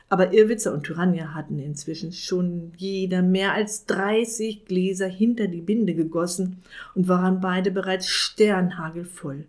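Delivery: usual speaking voice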